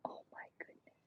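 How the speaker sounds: barely voiced whisper; soft whispered oh my goodness; whispered awe. Real, not acted. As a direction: breathe it out in a hush. Overwhelmed while ads play.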